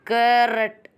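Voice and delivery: "करट" drawn out with a pressed first syllable; deliberate teacher's model of "करट"; syllable stress demo. Shown as a demonstration. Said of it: "दबाव दे के बोलते हैं".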